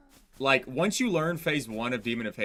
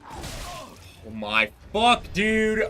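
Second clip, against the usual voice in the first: frustrated disbelief; 9 semitones higher, much slower than usual. Real, not acted. Hit despite rolling in game.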